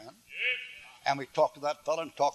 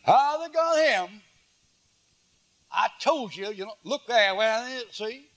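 high, drawn-out gleeful jeer, then higher-pitched I-told-you-so; mock gloating celebration; 7 semitones higher, slower than usual. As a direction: crow over someone's downfall in mockery. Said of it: "he begins to celebrate".

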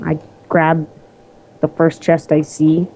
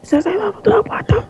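high disbelief; faster than usual. Real, not acted. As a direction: repeat it much higher, baffled. Surprised repeat.

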